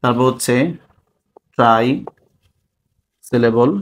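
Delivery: word by word with long pauses; dictation. Real, not acted. Teacher giving students terms to write down, dictating.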